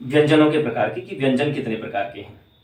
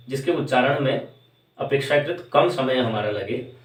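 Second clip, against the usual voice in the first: ordinary talk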